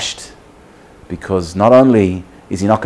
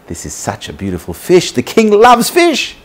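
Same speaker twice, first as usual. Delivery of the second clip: excited; 9 semitones higher than usual. Acted. Fisherman marvelling at his catch for the king, building excitement. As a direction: build from wonder to a high, eager exclamation. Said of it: "he's so excited".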